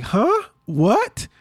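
high-pitched imitation of a baffled niece; puzzled child's reaction. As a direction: play it clueless, jumping up high on "Huh?".

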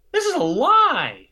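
pleading high and loud; indignant protest. Retold or imitated. Pleading with the board members, insisting it's a lie. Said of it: "be like".

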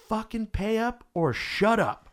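high, slow mock yell; imitated yelling. Imitating a stripper yelling at a heckler from the stage, mock yell. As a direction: draw it out high, a held-back scolding holler. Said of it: "And she yells at him".

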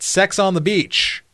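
high, slow crowd shout; yelled out. Retold or imitated. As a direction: call it out like an audience member. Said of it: "they yelled out".

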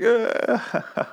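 very high-pitched crazed cry breaking into laughter; mock maniacal outburst. Bitten man turning on his captors, mock maniacal laugh. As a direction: fake an unhinged wail, far above normal pitch. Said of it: "laughs maniacally".

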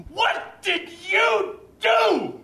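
very high, drawn-out accusing scream; imitating a furious five-year-old's shriek; angry child's scream. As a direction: scream it word by word like a tearful kid. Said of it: "he screams at me".

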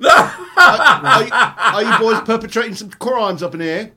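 high, slow Cockney challenge; Cockney bobby, hammed up high; Cockney accent. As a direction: play a cheeky Cockney copper. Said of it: "Depends on what his voice sounds like".

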